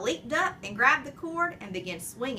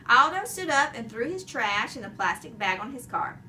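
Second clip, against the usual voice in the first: ordinary delivery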